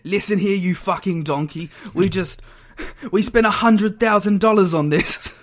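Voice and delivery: exasperated chef's telling-off, acted out; Gordon Ramsay. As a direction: chew someone out, building to a shout. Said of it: "and then Gordon was like".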